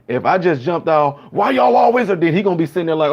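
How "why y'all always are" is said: mock angry outburst. Jumping out at the cop.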